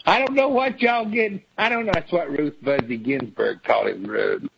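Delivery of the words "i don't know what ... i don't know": higher, sassy mock senator; Lindsey Graham impression. Mocking Lindsey Graham shrugging off the articles. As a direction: camp it up like a fussy senator. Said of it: "Lindsey Graham sitting over here".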